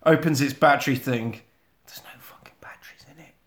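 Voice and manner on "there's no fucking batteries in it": shocked whisper. Retold or imitated. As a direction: breathe it out in horror. Shocked discovery.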